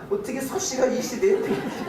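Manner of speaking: chuckling through a joking question; laughing. Real, not acted. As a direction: laugh as you wonder aloud. Joking about his wife's surname. (웃으면서 농담처럼)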